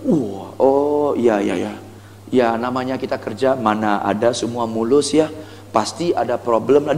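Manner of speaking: low, softened sympathy; concerned. Shown as a demonstration. Salesperson sympathizing with a stressed customer, concerned. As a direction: drop low and commiserate gently. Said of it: "intonasi Anda harus concern".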